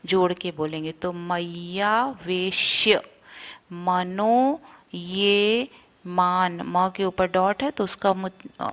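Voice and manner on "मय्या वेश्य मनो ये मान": slow मय्या वेश्य मनो ये मान, half श joined; joined syllables. Shown as a demonstration. Teacher drilling the phrase word by word. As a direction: link the half श to व, very slowly. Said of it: "उसको हम व से जोड़ के बोलेंगे".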